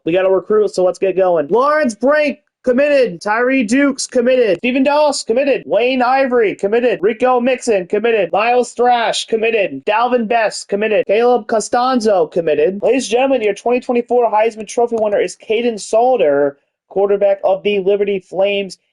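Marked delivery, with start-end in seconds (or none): 1.5-12.8 excited. Real, not acted. Reads out each signed recruit. 12.8-18.8 raised, hammed-up awards-show voice; ladies-and-gentlemen presenter voice; mock announcer.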